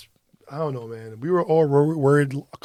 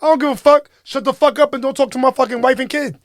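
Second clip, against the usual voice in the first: much higher and louder, defiant and fast by the end; furious shout; 10 semitones higher, 9 dB louder, faster than usual. Retold or imitated. Stranger cursing the husband out on the street, furious shout. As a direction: refuse to back down, full volume. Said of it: "And she was like".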